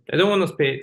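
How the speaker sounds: usual speaking voice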